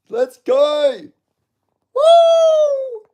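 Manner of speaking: high, loud whooping cheer; celebration whoop. Real, not acted. Fan celebrating Ralston's win, high loud whoop. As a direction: let out a big victory whoop.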